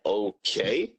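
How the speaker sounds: drawn-out "okay" sliding up at the end; retold wary okay. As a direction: act out a puzzled, questioning okay. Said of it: "And I'm like".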